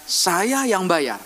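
slow, calm, deliberate insistence; slow firm insisting. Retold or imitated. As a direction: lay each word down slowly. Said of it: "Dia bilang gini". (pelan dan tegas)

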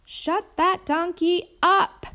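slow, high, loud shout; drawn-out furious neighbour yelling; angry yell. A narrator's reading. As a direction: holler it at the neighbours, word by word. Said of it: "Missus Tooley called out".

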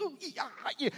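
high cartoon noise of disgust; Bugs Bunny. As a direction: squeal a recoiling cartoon reaction. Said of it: "Bugs Bunny makes in the cartoons".

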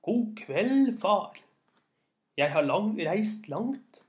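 loud, high, deliberate greeting; speaking into ear trumpet. A narrator's reading. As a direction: project into an old man's hearing aid. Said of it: "mannen snakket inn i høreapparatet".

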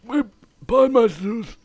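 loud proud shout, slow. Real, not acted. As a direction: shout the show's name proudly. Shouted show slogan.

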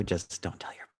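hushed, secretive aside; playful whispered loophole; conspiratorial whisper. Pretend. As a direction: drop to a whisper as if sharing a secret. Mock secret aside in ad read.

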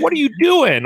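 high, sharp what are you doing; startled and a bit loud; wife's surprised question. Retold or imitated. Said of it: "Shannon's like".

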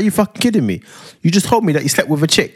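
high, indignant imitation; vexed girlfriend. Woman confronting boyfriend about his confession, vexed. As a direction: play her disbelieving telling-off. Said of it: "She's like; She was vexed".

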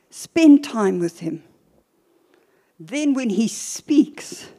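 ordinary talk, nothing marked

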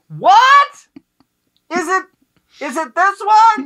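high, loud shouted question; yelling across the room. Retold or imitated. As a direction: shout back from upstairs. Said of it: "And I'm like".

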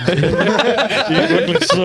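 real laughter pushes each word out, high and slow; can barely get the words out for laughing. Real, not acted. Telling his friends about the club and cracking up.

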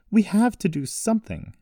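high, loud protest; insistent high yell. A narrator's reading. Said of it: "she yelled".

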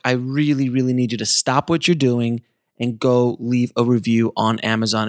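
normal talking voice

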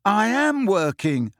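high, slow, indignant protest; nettled. A narrator's reading. Harry defending his effort to Hermione, nettled. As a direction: draw it out high, stung by the criticism.

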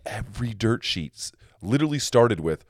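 normal talking voice

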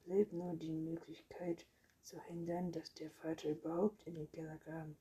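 ordinary delivery

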